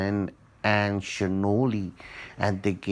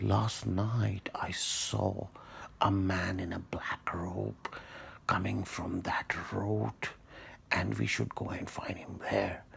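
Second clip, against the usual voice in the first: whisper; 7 dB quieter than usual. Acted. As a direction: whisper it like a scary secret to a child. Old man's secret, hushed report.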